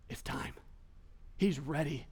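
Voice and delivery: very soft, low and secretive; hushed anticipation. Acted. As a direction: murmur it as the big moment arrives. Disciples' awed realization, voiced.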